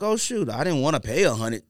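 usual speaking voice

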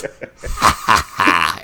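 mock evil laugh in spaced-out beats; deliberate, theatrical "ha, ha, ha"; imitated villain laugh. Imitating the devil laughing at the camera, mock villain laugh. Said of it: "And then he goes".

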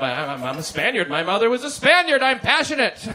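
put-on passionate Spaniard girlfriend. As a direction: rise up into her high, loud passion. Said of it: "She's like".

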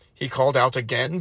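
usual speaking voice

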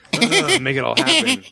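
Tom Cruise. Retold or imitated. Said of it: "a Tom Cruise where he's just like".

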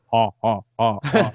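echoing monster cackle, mimicked; imitated monster laugh. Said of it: "you hear the echo of".

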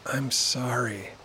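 soft, slow, subdued apology; sighing apology. A narrator's reading. Amina to her friend at the limo, sighing apology. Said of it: "Amina sighs and says".

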